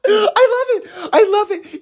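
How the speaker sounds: very high, giddy squeal through laughter; laughing delight. Real, not acted. Fan delighted by Buggy's absurd promotion, laughing squeal.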